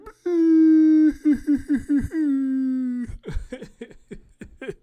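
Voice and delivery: high, loud howling laugh; loud cackling laugh. Real, not acted. Laughing at his own deuces joke to viewers, loud howling laugh. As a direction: burst out laughing till it nearly turns to crying.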